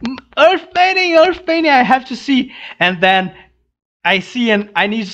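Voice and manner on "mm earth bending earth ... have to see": high, thrilled "earth bending, earth bending"; a fan who can't wait; giddy retold anticipation; eager excitement. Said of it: "I was like".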